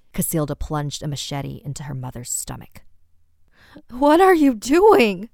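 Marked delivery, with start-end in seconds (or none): 3.9-5.3 high, loud, slow scream of horror; shocked scream. Acted. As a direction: scream it out in terror. Mother stabbed by daughter.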